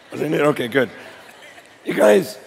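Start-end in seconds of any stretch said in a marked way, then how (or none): 1.8-2.4 worn-out hoarse voice; rough, strained, tired voice. Real, not acted. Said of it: "Sorry, my voice is gone from the week".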